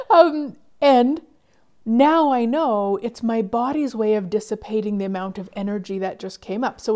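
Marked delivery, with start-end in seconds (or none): none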